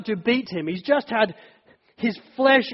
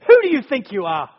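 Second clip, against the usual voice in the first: louder, indignant challenge; defiant challenge; 3 semitones higher, 7 dB louder than usual. Retold or imitated. A person shaking a fist at God, indignant. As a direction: throw the question at God in anger. Voicing someone raging at God.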